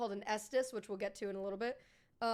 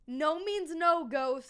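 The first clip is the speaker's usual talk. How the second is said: loud, higher, playful telling-off; mock scolding; 4 semitones higher, 8 dB louder, much slower than usual. Streamer scolding a ghost about consent, mock stern. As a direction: lay down the law in mock sternness.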